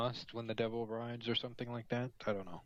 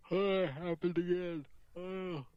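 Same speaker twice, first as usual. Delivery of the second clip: mid-yawn, voice pulled high; talking through a big yawn; 7 semitones higher, slower than usual. Real, not acted. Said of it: "apologies to the chat for continually yawning".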